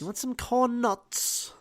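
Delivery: high, slow mock British; playful drawn-out British accent. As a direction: answer in a hammed-up British accent. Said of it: "We're going British".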